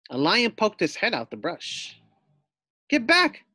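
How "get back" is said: high, sharp warning; fierce warning. Acted. Pig threatens a lion in the jungle, fierce. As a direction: scare off the lion. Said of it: "thought Fluffy".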